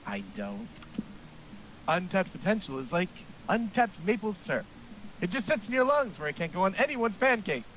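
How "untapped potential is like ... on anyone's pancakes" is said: lightly raised coach voice; eager gym coach pep talk; hearty coach voice. Acted. Coach voice continues.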